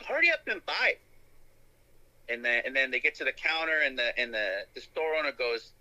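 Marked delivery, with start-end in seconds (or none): 0.0-0.9 high put-on Chinese accent, pitched way up; imitating the shopkeeper lady in a mock Chinese accent; old Chinese lady. Retelling the film scene in a mock Chinese accent. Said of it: "she says".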